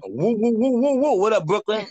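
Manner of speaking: high, hyped-up New York whooping; New York hype. Shown as a demonstration. Tarot reader whoops back at a Brooklyn caller, New York hype. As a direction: whoop it out quick and playful. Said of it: "That's how we talk".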